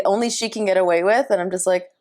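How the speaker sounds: ordinary talk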